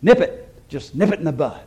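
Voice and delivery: a quick comic nod to the nervous deputy; Barney Fife impression. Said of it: "that very famous theologian, Barney Fife".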